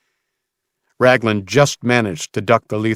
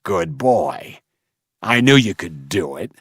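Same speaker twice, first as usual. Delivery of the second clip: gloating villain. A narrator's reading. Slaver gloats at boy.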